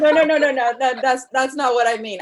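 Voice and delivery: loud, laughing no no no; real laughter, protesting loudly. Real, not acted. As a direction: backpedal while still laughing. Laughing backpedal to host.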